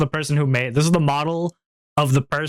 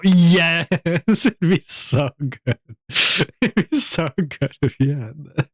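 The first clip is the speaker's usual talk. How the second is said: laughing through the words; 4 semitones higher, 3 dB louder, slower than usual. Real, not acted. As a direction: giggle it out excitedly. Laughing excited at toggle idea.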